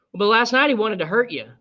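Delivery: a hint of a girl pointing out a wrong; young Scout. A narrator's reading. Scout protesting to her father, lightly voiced child.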